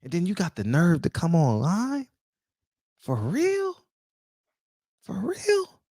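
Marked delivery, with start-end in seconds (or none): 3.0-5.8 mock disbelief. Real, not acted. Repeated incredulous jab.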